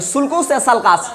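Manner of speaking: first sulcus as "sulkus", second sulcus as "salkas"; contrasting two pronunciations. Shown as a demonstration. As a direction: offer both: sulcus with rounded "oo" vowels, then sulcus with "a" vowels. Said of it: "तो कैसे pronounce करोगे".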